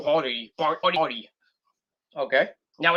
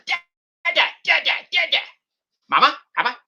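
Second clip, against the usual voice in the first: put-on high babbling baby, pitched way up; 10 semitones higher than usual. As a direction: coo like a toddler on a home video. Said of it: "on Red Instagram".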